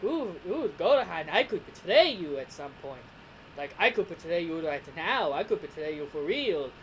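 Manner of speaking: gloating villain, pitch swooping up and down; put-on cartoon villain voice; Frieza. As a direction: taunt with a theatrical lilt. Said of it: "it's because that's his English dub voice".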